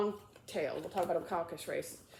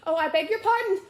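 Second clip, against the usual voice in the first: high, loud, hurried apology; hasty cry; 11 semitones higher, 9 dB louder than usual. Acted. Alice apologising to the mouse in a hasty cry. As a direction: blurt the apology out. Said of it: "cried Alice hastily".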